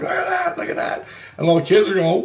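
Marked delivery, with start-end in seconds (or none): 0.0-1.0 high, giddy showing off; high gleeful voice. Retold or imitated. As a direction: coo it like flaunting a prize. Showing centerfold to kids.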